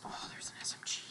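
hushed whisper on spotting loot. Real, not acted. Gamer spotting a weapon, whispered. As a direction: whisper it as if sneaking.

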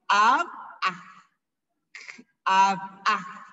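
"Ab, ah" with a hard throat click before a punched "Ab"; forceful apostrophe sounds. Shown as a demonstration. Teacher showing how arm is said, forceful split "Ab, ah". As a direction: punch "Ab" from the throat, pause, then "ah". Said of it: "lo tengo que mencionar fuerte".

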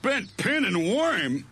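high slow sneering Fred voice; scornful. A narrator's reading. Fred mocks Barney's bent pin and worm, slow scornful sneer. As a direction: scoff at each word slowly. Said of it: "said Fred scornfully".

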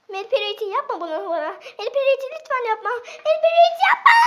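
begging, rising to a high shout; plead, then scream it. Real, not acted.

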